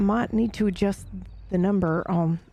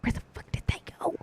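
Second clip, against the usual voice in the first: whispering; 11 semitones higher, 17 dB quieter than usual. Real, not acted. Alone, lost companions.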